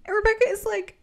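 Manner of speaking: ordinary delivery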